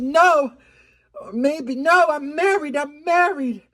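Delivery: high, mock-panicked refusal; flustered protest. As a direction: resist temptation, pitch shooting up. Acting out torn, flustered refusal.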